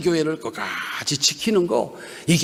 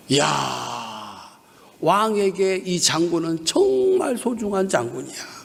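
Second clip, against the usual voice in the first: usual speaking voice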